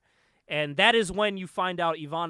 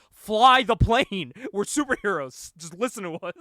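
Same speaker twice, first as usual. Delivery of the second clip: mock threatening order; 2 semitones higher, 9 dB louder than usual. Retelling superheroes pressuring a reluctant pilot, loud mock-threatening order. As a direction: act out heroes bullying the pilot, first words loudest. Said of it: "They, like, threaten him".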